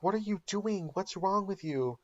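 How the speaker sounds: high, shocked mock alarm; alarmed question. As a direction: react to something bizarre. Skylar alarmed at Jesse.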